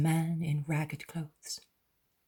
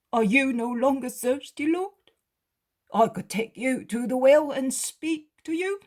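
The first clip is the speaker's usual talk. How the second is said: higher voice for the beggar, wondering; a poor man asking why, raised and high; ragged beggar, puzzled; 10 semitones higher, 8 dB louder than usual. Acted. Beggar offers to take Jesus.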